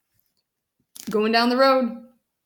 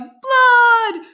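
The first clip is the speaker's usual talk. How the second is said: high, loud alarmed yell; =full-voiced storybook shout; 12 semitones higher, 6 dB louder than usual. A narrator's reading. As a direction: yell it long and let it fall. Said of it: "Loretta's father yelled".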